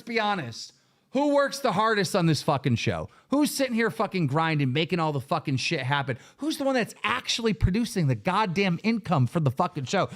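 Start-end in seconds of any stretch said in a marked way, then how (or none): none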